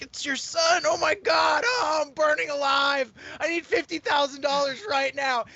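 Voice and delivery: hammed-up high falsetto cries for help; shrieky fake emergency plea, very high; mock panicked son. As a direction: play a scam caller faking desperate panic. Fake son's panicked scam call acted out.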